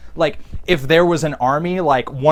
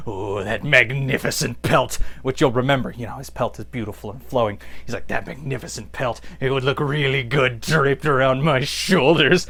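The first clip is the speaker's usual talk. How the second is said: creepy admiring; 4 semitones lower, 3 dB quieter than usual. Retold or imitated. Triclops admiring Beastman's pelt in a low creepy voice.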